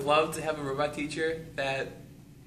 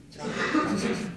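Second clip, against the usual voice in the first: higher, laugh-choked traurig; student losing it mid-repetition; 8 semitones higher than usual. Real, not acted. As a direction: giggle through the word. Student laughs while repeating.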